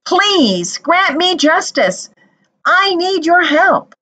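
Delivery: a poor widow begging the judge; pleading widow. A narrator's reading. Widow begging the judge for justice, pleading. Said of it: "The widow said".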